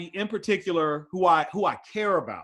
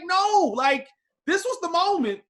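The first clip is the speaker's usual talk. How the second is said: high, pressing insistence; urgent insistence; 10 semitones higher, 5 dB louder than usual. Retold or imitated. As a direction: push it like the moment is now. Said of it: "And I'm like".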